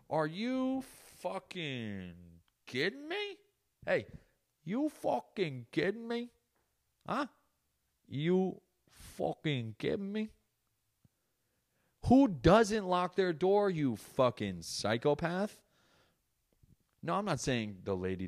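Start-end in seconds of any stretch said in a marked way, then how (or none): 0.1-10.3 high, slow, squeezed disbelief; incredulous outrage. Acted. Outraged at woman not locking door. 12.1-15.6 loud, slow, high-pitched rant; exasperated scolding. Acted. Scolding the woman rhetorically.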